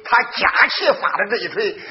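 usual speaking voice

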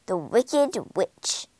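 usual speaking voice

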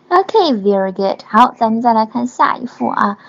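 ordinary talk

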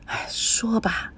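slow hushed whisper, barely voiced; whispered reply. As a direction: whisper back, keeping it secret. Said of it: "小声地问". (缓慢的悄声耳语)

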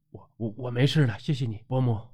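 soft, hurried faltering thanks; weak stammer. A narrator's reading. Xiaotao thanking Auntie after being freed, weak stammer. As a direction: stumble softly over the opening, then thank meekly. Recovering after needle treatment.

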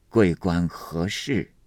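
put-on slow old Taoist voice, unhurried; faintly amused Taoist. A narrator's reading. Old Taoist receives an official at his hut, faintly amused. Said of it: "微微笑道".

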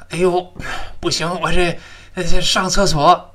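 high, pressed urgent plea; sudden urgent need. Acted. Teacher acts out a sudden toilet need among friends, urgent. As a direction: act out being caught short.

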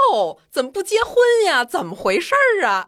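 high, loud, hammed-up gasp of disbelief; exaggerated shock. Nosy neighbor loudly mocks the unmarried daughter, exaggerated shock. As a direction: play up the old lady's shock. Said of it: "巨夸张".

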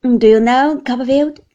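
jolly friend at the table; cheerful Traddles. A narrator's reading. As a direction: Traddles cheerfully addressing his host. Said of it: "cheerfully examining the dish".

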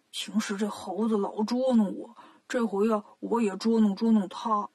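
a hint of a sly Bajie; voiced Bajie scheming. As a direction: plot the prank with mischief. Bajie plotting a prank.

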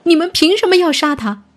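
high, loud indignant challenge; loud shout. Acted. Companion defending the accused before angry villagers, shouting indignantly. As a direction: shout the crowd down, pitched high. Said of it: "立马站起来大声喊道".

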